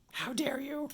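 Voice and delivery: high-pitched voice of an outraged dog; indignant dog. Acted. Territorial dog at someone crossing the street, indignant. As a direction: act out a dog taking offence. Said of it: "And the dog is like".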